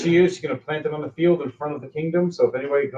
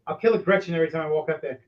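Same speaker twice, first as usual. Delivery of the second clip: ordinary talk, nothing marked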